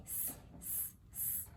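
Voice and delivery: short voiceless "s" hisses; isolated letter sound. Shown as a demonstration. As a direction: hiss "s" alone, softly, no vowel. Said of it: "los sonidos".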